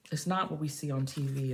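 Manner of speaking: ordinary talk, nothing marked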